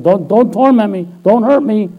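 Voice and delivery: high, loud mock pleading; acted plea, pitched well up; frightened plea. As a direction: imitate a spirit begging for mercy. Voices the spirits pleading with Jesus.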